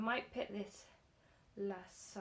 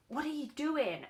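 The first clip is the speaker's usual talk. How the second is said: high, loud panicked cry at the cat; alarmed shout; 7 semitones higher, 9 dB louder than usual. Retold or imitated. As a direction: yell it in sudden alarm.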